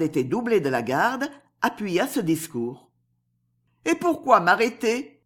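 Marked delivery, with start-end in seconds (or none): 3.8-5.2 lightly raised, innocent pitch; innocent question. A narrator's reading. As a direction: ask as if you know nothing. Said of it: "dit innocemment Esther". (question innocente, un peu aiguë)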